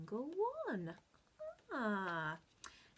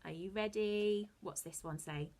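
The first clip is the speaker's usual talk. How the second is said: normal talking voice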